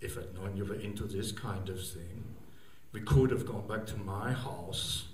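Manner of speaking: put-on low, hushed German accent; slow, quiet German-accented menace. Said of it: "in a very German voice".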